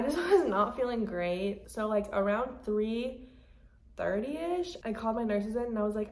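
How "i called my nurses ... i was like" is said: ordinary delivery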